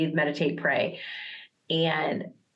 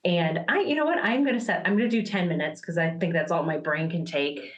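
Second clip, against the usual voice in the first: ordinary talk, nothing marked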